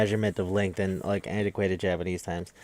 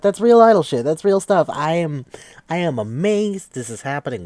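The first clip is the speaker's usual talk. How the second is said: much higher and louder, fired up; excited exclamation; 7 semitones higher, 7 dB louder than usual. Real, not acted. Fan telling a listener about idol drama, excited exclamation. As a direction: blurt it out like a thrilled fan.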